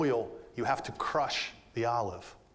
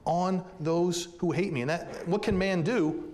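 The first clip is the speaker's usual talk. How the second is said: higher, louder, pressing preacher's cry; impassioned pulpit voice; full-force preaching; 6 semitones higher, 4 dB louder than usual. Acted. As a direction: drive the verse home to the congregation.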